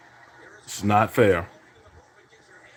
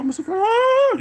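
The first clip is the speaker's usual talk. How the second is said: mock wail; 3 dB louder than usual. Mock wail at beaten opponent.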